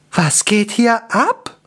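high, slow elf bewilderment; bewildered high-pitched elf; startled blurt. A narrator's reading. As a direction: let it escape in high, drawn-out surprise. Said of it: "entfuhr es Anker".

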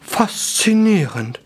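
slow, high murmur of wonder; drawn-out, theatrical wizard awe; awed wizard. A narrator's reading. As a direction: savour the word in fascination. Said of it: "hauchte Gaston".

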